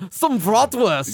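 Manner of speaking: a playful fake-German offer of sausage; mock German voice. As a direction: draw out the bratwurst like a cheesy seducer. Continuing the German food bit.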